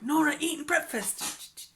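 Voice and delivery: mock paparazzi. Vlogger jokes about sly pictures of a friend, playful mock paparazzi. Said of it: "You get, like, the sly picture".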